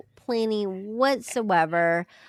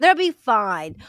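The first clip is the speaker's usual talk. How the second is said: louder, breezy fake reassurance; mock nonchalance; 3 semitones higher, 8 dB louder, slower than usual. Organizer dismissing missing chairs at the event, mock-breezy. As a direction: wave the problem away in mockery. Mocking an organizer shrugging off problems.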